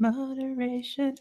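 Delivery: high, drawn-out mimicry; mocking echo. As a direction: stretch the word teasingly. Mockingly echoes co-host's word.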